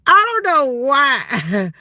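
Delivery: laughing till it sounds like crying; laughing fit. Real, not acted. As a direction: lose it laughing, then drag the words out.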